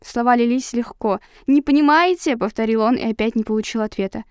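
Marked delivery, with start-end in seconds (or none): 1.5-2.4 high, loud contemptuous question; angry demand. A narrator's reading. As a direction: fling the question back. Savage repeats question angrily.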